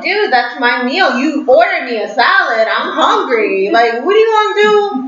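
retold indignant complaint, pitch jumping high on the questions; indignant protest. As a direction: scold a date in disbelief, drawing out key words. Retells angry protest to date.

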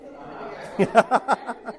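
breathy embarrassed laugh; sheepish laugh. Real, not acted. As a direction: laugh at your own slip. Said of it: "otra versión que no está aquí". (risa avergonzada)

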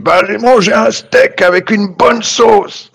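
high put-on voice for the reply; silly player voice.

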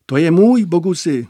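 loud call back. A narrator's reading. Stocky senior answers from across room.

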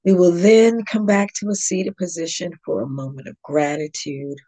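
calm, measured meditation guide with long pauses; guided yoga nidra. Real, not acted. Yoga teacher guiding listeners into yogic sleep, calm meditation voice.

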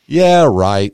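loud dismissive scoff; a loud sarcastic yeah, right. Retold or imitated. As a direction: brush it off loudly. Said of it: "And they go".

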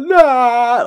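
high, loud mock wail of despair; imitating Leatherface's grief; anguished cry. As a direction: cry out like a distraught killer. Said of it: "he's like".

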